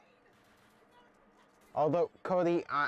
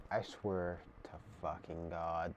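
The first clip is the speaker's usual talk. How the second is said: muttered; 8 semitones lower, 10 dB quieter than usual. Real, not acted. Muttering anger under breath.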